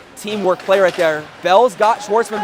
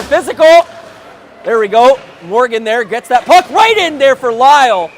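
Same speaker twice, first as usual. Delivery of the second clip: much higher and louder call; excited shout; 5 semitones higher, 7 dB louder than usual. Real, not acted. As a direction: burst of excitement at the hit. Big hit and chance.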